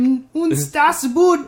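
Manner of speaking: high, slow mock German; hammed-up fake German accent. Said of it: "my German impressions are fake German".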